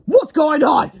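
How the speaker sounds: bully barging in; bully's yell. Acted. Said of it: "yelled Kipper".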